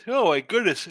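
yawned exclamation; sleepy yawn mid-sentence. Real, not acted. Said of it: "I'm yawning like a maniac today".

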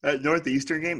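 talking through a yawn; words stretched by a yawn. Real, not acted. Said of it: "excuse my yawning".

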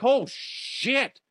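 a moviegoer hollering at a horror film; yelling at the screen. Retold or imitated. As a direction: shout at the character on screen. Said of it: "be like".

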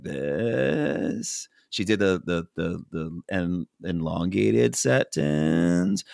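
slow, stretched-out mock of an annoying voice; annoying drawn-out voice. Mocking a houseguest, imitating her slow, stretched annoying voice. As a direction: drag every word out lazily, half-mocking.

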